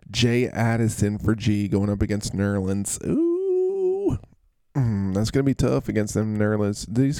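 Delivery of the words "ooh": high ooh. Acted. High exclaim.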